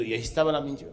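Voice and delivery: ordinary delivery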